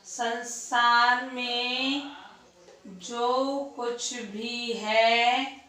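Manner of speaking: very slow, word by word with long pauses; slow dictation. Shown as a demonstration. Teacher dictating the line for students' notes, very slow. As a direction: dictate each word so students can write it down. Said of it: "झूठन लिख दो".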